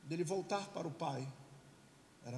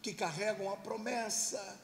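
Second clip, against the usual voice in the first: loud, forceful sermon climax; full-force preaching; 2 semitones higher, 8 dB louder than usual. Real, not acted. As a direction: proclaim it to the crowd, stretching the last phrase.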